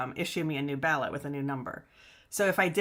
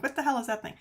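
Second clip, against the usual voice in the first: startled; 7 semitones higher, much faster than usual. Retold or imitated. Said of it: "I'm like".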